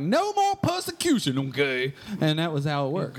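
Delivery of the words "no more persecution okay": high pitched mock pilgrim shout. Pilgrim stepping off the boat, high mock cry. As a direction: squeal it like a freed pilgrim. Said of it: "they were like".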